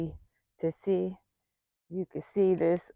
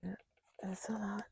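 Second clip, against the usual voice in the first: whisper; 15 dB quieter than usual. Real, not acted. Whispering to herself while spraying.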